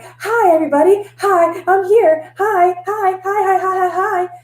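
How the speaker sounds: high, giddy cheerful hellos; sing-song hyper-happy welcome; bubbly barista greeting. Retold or imitated. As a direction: chirp it like an overexcited barista. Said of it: "So I was always just like".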